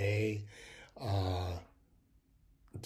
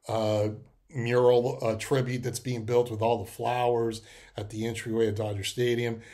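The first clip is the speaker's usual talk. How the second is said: ordinary talk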